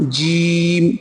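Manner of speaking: জিম said with the jaws pressed a little; জিম through lightly pressed teeth. Shown as a demonstration. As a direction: clench slightly and say জিম. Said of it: "চেপে একটুখানি উচ্চারণটা হবে".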